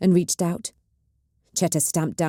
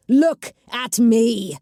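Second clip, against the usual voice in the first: slow, loud, drawn-out command; slow shout; 5 semitones higher, 5 dB louder, much slower than usual. A narrator's reading. Cheta demanding the witch look up, slow loud shout. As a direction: drag every word out at her.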